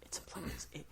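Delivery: hushed, halting whisper; conspiratorial whisper. Pretend. Insisting on the game's genre in secret, conspiratorial whisper. Joking secret about platformer.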